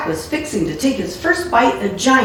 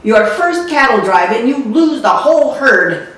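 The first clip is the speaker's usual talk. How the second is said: raised, loud angry scold; furious scolding; 4 semitones higher, 5 dB louder than usual. Acted. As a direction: let her fury spill over the lost herd. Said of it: "she was furious".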